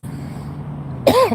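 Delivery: a sharp, rough clearing of the throat. Real, not acted. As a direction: clear the throat before talking on. Clearing dry throat on camera.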